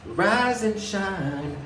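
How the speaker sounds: very high, very slow drawn-out sing-song; hammed-up high sing-song greeting; mock wake-up call. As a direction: stretch it out in a high cooing wake-up voice. Sudden sung-out meme line.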